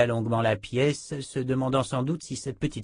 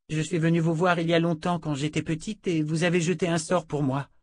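lightly voiced, higher young woman; earnest young woman; 7 semitones higher than usual. A narrator's reading. Jensen recalls a childhood visit, earnest explaining.

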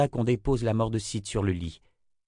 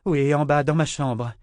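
higher and louder, pressing; urgent call; 5 semitones higher, 5 dB louder than usual. A narrator's reading. Nietzsche tells the men where to carry Kara, urgent and louder. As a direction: answer fast and loud as if rushing. Urgent reply in rescue scene.

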